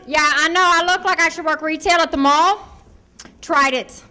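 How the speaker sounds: ordinary delivery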